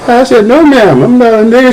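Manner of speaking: laughing through the retold answer, pitch up high; can't hold back a laugh while quoting himself. Real, not acted. Laughing while retelling his answer.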